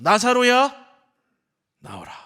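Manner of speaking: loud, high summoning shout; proclaiming call. Retold or imitated. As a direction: call the dead man out with a commanding cry. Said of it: "선포하시죠".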